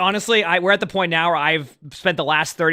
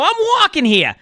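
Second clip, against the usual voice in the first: New York "I'm walking here"; 11 semitones higher, slower than usual. Retold or imitated. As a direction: belt it like a cabbie in New York. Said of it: "I'm not gonna go".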